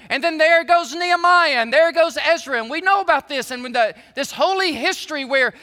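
fiery preaching, loud and high. Acted. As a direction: preach it out with rising force. Preaching at full force.

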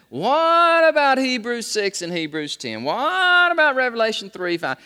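caricatured doubter's whine; mocking objector. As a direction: ham up the skeptic's objection. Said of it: "And then".

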